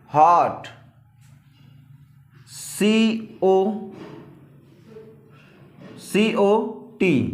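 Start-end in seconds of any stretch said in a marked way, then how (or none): 2.5-7.2 letters drawn out one by one; slow spelling. Shown as a demonstration. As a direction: spell it out very slowly. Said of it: "spelling out letters". (अक्षर धीरे-धीरे बोलना)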